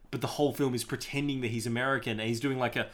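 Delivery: normal talking voice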